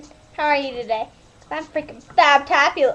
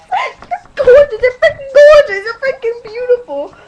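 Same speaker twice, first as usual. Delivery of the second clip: high, louder, building to a shout; over-the-top gushing compliment; gushing excited; 7 semitones higher, 8 dB louder than usual. Real, not acted. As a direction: gush and build until yelling.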